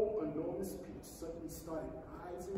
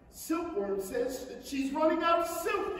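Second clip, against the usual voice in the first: a touch raised, higher call; mild yell; 8 semitones higher, 3 dB louder than usual. Acted. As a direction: call up a warning from below. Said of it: "yelled the centipede".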